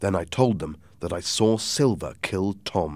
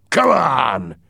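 angry order; 5 semitones higher, 9 dB louder than usual. A narrator's reading. Silver storms off angry.

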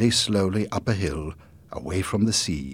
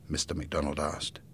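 ordinary delivery

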